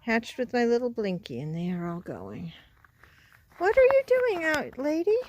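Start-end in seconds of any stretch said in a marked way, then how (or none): 3.6-5.2 pet talk. Real, not acted. Talking to animals, high pet voice.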